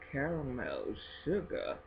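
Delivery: normal talking voice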